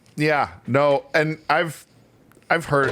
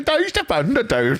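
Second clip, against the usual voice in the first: mock Norwegian accent; 11 semitones higher, slower than usual.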